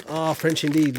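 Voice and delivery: normal talking voice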